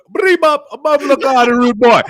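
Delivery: high, loud Jamaican dancehall shout; Jamaican accent. Retold or imitated. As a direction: belt it like a dancehall MC. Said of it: "he goes".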